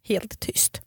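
hushed, whispered last words. Acted. Reading a silent-haircut booking to cohost, whispered. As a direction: drop into a whisper to close the ad.

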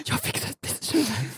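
high, clearer voiced repeat of "Jag fixar ett Playstation fem". [name] repeating his whispered bribe aloud for Joel.

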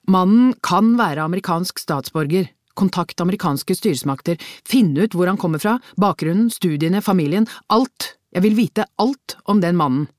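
loud voice. A narrator's reading. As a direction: demand everything, loudly into the phone. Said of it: "sa Karsten med høy stemme inn i telefonen". (med høy, pågående stemme)

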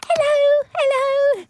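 very high, squeaky little squirrel greeting. Pretend. Host answers in her own high, squeaky squirrel voice. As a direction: chirp hello like a tiny squirrel. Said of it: "That is a lovely little squirrel voice".

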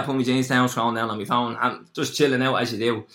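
ordinary delivery

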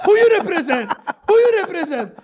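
mock-furious Swedish tourist yelling at staff; furious retold shout. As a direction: bark it out, losing your temper. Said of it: "jag var helt galen".

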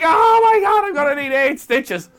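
high, loud cry of alarm; mock panic. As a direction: act out a hurt person's dismay. Acted reaction to an injury.

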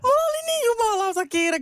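high, fake-sob complaint; mock crying. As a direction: whimper it like a hammed-up cry. Mock sobbing over rushed morning. (leikkiitkuinen valitus)

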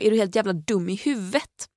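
normal talking voice

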